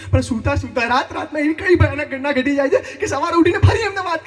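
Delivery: worked-up stage tirade, punchlines pushed hard; exasperated comic rant. Acted. Yash complains to his friend about girlfriends, exasperated ranting.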